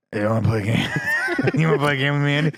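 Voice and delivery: put-on drunk uncle. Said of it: "sound like a drunk uncle".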